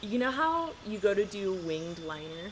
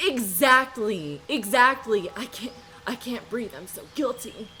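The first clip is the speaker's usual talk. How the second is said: breathless laughing; 3 semitones higher, 7 dB louder than usual. Real, not acted. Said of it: "I can't really catch my breath".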